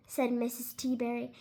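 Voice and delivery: ordinary delivery, nothing marked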